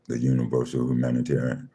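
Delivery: ordinary talk, nothing marked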